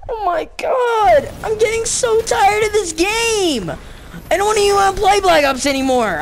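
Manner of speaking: exasperated rant. Real, not acted. Gamer rants about game.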